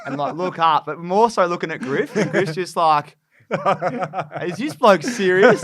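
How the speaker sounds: high and loud, laughing through it, slowing down; cracking up while telling the prank, high and loud; laughing retell. Retold or imitated.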